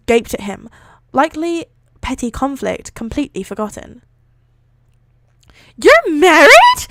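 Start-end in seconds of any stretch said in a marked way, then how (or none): 5.8-6.9 loud, high, stretched squeal of a question; high-pitched shout. A narrator's reading. Invisible student to her teacher on his marriage, high-pitched shout. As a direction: shriek it in disbelief, drawn out. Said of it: "A high-pitched shout came from midair".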